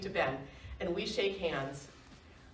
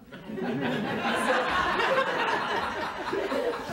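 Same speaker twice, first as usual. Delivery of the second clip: high falling burst of laughter; hearty laugh; 10 semitones higher, 4 dB louder than usual. Real, not acted. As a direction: laugh at your own punchline. Laughing at her own punchline.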